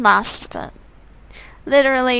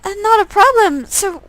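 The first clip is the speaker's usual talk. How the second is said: put-on much higher, bubbly young woman; high girl voice; 10 semitones higher than usual. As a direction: play Catelyn, surprised then chatty. Catelyn continues talking to Tyrone.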